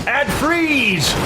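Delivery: high, hammy spell shout; dramatic battle cry. Pretend. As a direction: shout it as you cast ice.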